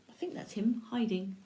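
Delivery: soft, quick near-whisper; quietly confiding, almost whispered; hushed aside. Real, not acted. Hushed aside about the picture.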